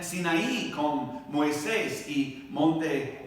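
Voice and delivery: usual speaking voice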